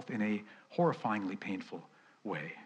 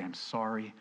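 softer, hushed plea for forgiveness; confessing prayer; 3 semitones lower, 8 dB quieter than usual. Pretend. Preacher voicing a quiet confession to God. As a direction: confess gently, almost a whisper. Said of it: "and say".

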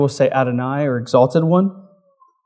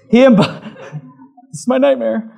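higher, breaking into real laughter mid-word; embarrassed laugh; 7 semitones higher, faster than usual. Real, not acted. Pastor flustered by stray music, laughing at himself. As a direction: laugh off the mishap. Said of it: "you gotta laugh".

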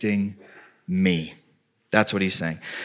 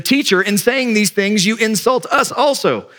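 a hint of an indignant scribe, pitched higher; lightly voiced offended lawyer; mildly huffy scribe protesting; 9 semitones higher than usual. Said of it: "One of the lawyers answered him".

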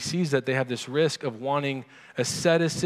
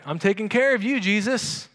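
cocky Peter; 8 semitones higher, 4 dB louder than usual. Retold or imitated.

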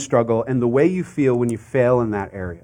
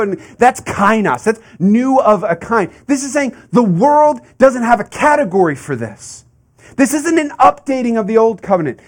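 higher and louder, driving sermon delivery; impassioned preaching; 8 semitones higher, 6 dB louder than usual. Acted. Preacher to congregation, fervent raised preaching. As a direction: preach it out at full force to the congregation. Preaching builds to full force.

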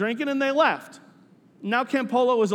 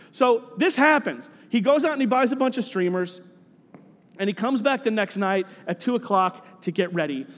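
ordinary talk, nothing marked

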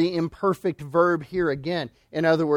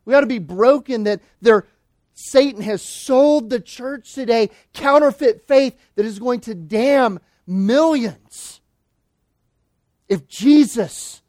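louder, higher, slowing on the warning; grieving preaching at full force; 7 semitones higher, 8 dB louder than usual. Acted. Preacher warning the church about counterfeit faith, preaching at full force. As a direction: warn them as if millions hang on it.

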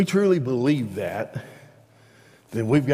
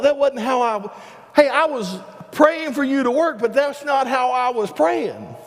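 much higher, excited and rattled; mock flustered surprise; 11 semitones higher, 3 dB louder than usual. Preacher acting out believers surprised God picked them, much higher and flustered. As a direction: act caught off guard by God's call.

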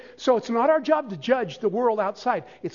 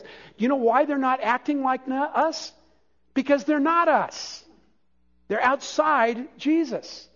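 fired-up preaching; 6 semitones higher than usual. Acted. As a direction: preach it hard, rising with conviction. Preacher builds to a peak.

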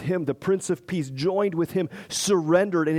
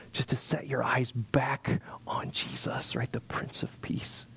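whisper; 5 dB quieter than usual. Real, not acted. Preacher whispers focus on Jesus.

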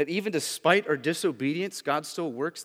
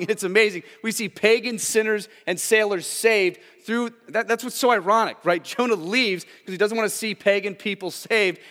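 raised, driving pulpit voice; fervent preaching; 6 semitones higher, 6 dB louder than usual. Acted. Preacher pressing mercy on the congregation, fervent and loud. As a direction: preach it out with fire to the church.

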